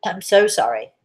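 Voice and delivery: usual speaking voice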